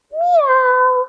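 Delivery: high squeaky meow; high cat meow. Shown as a demonstration. Teacher meows high for the kids in cat pose. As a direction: mew like a kitten, high up. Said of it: "back to your belly for cat".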